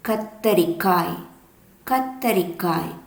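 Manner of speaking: கத்தரிக்காய் drawn out slowly, syllable by syllable; slow naming. Shown as a demonstration. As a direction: stretch கத்தரிக்காய் out for the kids. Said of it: "இது". (கத்தரிக்காய் மெதுவாக நீட்டி)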